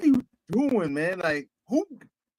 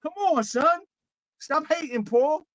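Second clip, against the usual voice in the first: higher Cam voice, easing back toward normal; Cam impression; 5 semitones higher than usual. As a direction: tease Paul in a lighter Cam voice. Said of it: "I'm gonna put my Cam voice on".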